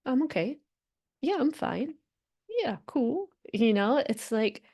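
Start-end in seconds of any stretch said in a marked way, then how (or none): 0.1-3.3 mock-casual little brush-off, pitched up; light, evasive imitation; dismissive "I'm fine". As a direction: fake being okay to avoid talking. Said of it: "for you to just be like".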